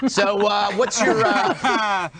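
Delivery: stumbling over the words with laughter; laughing question. Real, not acted. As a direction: try to ask it through laughter. Host cracks up while asking question.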